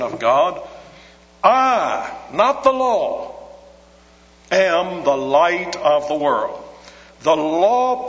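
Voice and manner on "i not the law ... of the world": slow, pointed contrast on I versus the law; emphatic claim. Acted. Preacher voicing Jesus setting himself over the law, slow emphatic stress.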